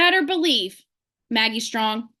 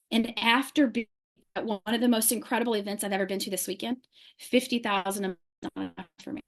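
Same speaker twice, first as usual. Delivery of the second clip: softer, lower, almost to herself; hushed; 5 semitones lower, 8 dB quieter than usual. Real, not acted. Telling the Zoom crowd her goal, hushed and low. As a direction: drop right down as if confiding.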